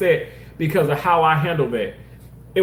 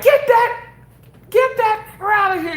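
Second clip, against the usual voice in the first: high, sneering mock patient voice; hostile patient; 4 dB louder than usual. Patient in pain snapping at the nurse, high and sneering. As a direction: spit it out like a patient who despises him. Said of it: "she literally calls me that".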